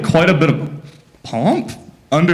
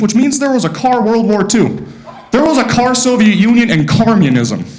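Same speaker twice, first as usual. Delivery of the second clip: excited rant; 8 semitones higher than usual. Real, not acted. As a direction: rant at chat about Cars logic. Ranting about Cars universe.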